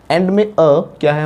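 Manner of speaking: ordinary talk, nothing marked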